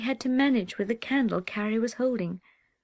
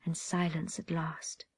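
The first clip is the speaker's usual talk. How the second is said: ordinary delivery, nothing marked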